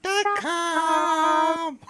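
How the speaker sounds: very high, stretched-out puppet voice; Peanut. Retold or imitated. Streamer echoes a puppet catchphrase for laughs, high puppet voice. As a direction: draw it out in a comic high squeal. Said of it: "Peanut voice".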